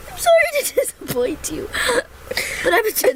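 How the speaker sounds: laughing. Real, not acted. Still laughing fit.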